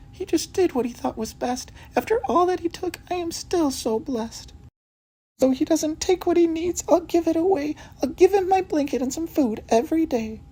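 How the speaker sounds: put-on very high, sleepy old cat voice; Missus Hudson's cat voice. Cat Missus Hudson tells detectives of her lost food, very high voice.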